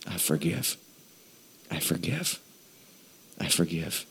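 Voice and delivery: very soft, low, near-whispered release; hushed prayer. Real, not acted. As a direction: let it out quietly and low after an exhale. Slowly releasing debts in prayer after exhaling.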